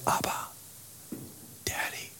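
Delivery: hushed whisper "Abba, Daddy". Shown as a demonstration. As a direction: breathe it intimately. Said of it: "when they refer to God the Father".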